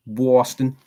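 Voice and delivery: "Boston" with a W glide before the O, Bwoston. Shown as a demonstration. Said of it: "put a W in front of the O".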